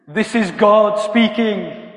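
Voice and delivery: slow, loud, booming voice of God; over-exaggerated God voice. Youth worker posing as God to Jacob, over-exaggerated booming voice. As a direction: stretch each word out grandly from on high. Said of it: "over-exaggerated way".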